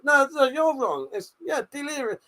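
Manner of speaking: fake-cry as if wounded; mock crying. Mock whining at being corrected.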